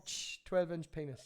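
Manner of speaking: normal talking voice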